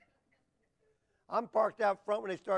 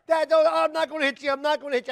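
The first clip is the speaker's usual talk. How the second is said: high, loud mock protest; hammed-up boyish panic, pitched up; imitated pleading son; 9 semitones higher, 11 dB louder than usual. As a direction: plead urgently like a boy refusing Dad. Son pleading with his father.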